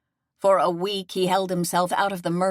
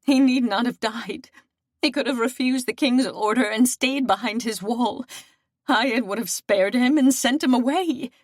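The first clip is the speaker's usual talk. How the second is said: tearful lament; 5 semitones higher than usual. Acted. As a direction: pour out the grief through tears. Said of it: "as she cried".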